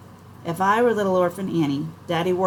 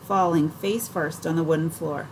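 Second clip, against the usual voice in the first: plain reading voice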